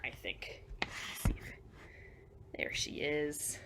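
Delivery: hushed whisper to the toy elephant. Real, not acted. Whispering to Eugenia the elephant listener. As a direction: whisper so as not to startle her.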